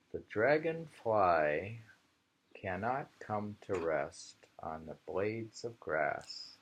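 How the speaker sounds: slow, measured haiku reading; poem reading. Acted. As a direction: read it like a poem, unhurried.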